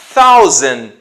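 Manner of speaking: thousand loud, starting on a clear th; "thousand" stretched as a th demo; TH sound. Shown as a demonstration. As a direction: project "thousand" with English th. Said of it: "Observando o som do T H ali".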